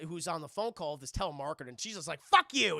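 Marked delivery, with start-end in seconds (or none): 2.2-2.8 loud, raised retort; mock exasperated cursing; fed-up outburst. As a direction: blow off a tiresome caller. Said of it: "she was tired of talking to him".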